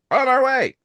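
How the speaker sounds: high, bright, eager answer; eager reply. Retold or imitated. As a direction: chirp it like a friend on the way. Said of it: "And they're like".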